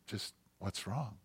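very soft, gentle question; hushed voice of a patient wife; quiet. Retold or imitated. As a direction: ask it quietly, without pushing. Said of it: "she just was quiet".

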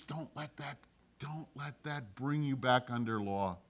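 soft, hushed warning from God; whisper. Acted. God whispers a warning to believers, hushed. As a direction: lean in and whisper it gently. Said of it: "God whispers and says".

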